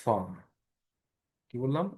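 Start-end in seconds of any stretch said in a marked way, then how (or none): 0.0-0.2 ফা with air pushed out between the lips; ফা said alone, led by a puff of air; breath-released FA. Shown as a demonstration. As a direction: let the air escape on the opening sound of ফা. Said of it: "বাতাসটা বের করে দিবেন".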